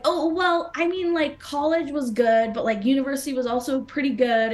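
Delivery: tripped up. Retold or imitated. Nervous candidate defending college to critical interviewers, tripping up. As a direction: stumble through a flustered justification. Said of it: "And then I tripped up".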